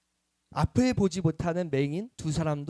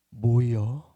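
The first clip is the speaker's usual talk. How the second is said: Chungcheong drawl; 8 semitones lower, much slower than usual. Retold or imitated. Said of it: "충청도에선 뭐라 그럴까?".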